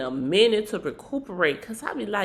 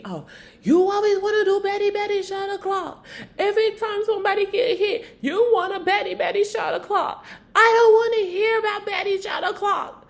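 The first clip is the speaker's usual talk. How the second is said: much higher and a bit louder, fast, whiny mocking rant; mocking angry Biggie; 12 semitones higher, 3 dB louder, faster than usual. Biggie, pissed off at Nally, mocking her suggestion in a high whiny rant. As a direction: throw "Betty Betty shot o'clock" back at her, pitched way up, mocking. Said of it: "that piss Biggie".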